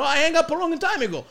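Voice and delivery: high and loud, a clueless dad. Retold or imitated. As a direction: insist he already hung up. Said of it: "He goes".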